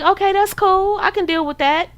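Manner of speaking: pitched well up, acting breezy and fine with it; mock easygoing agreement. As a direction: play the cool girl who pretends it doesn't bother her. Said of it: "So you're like".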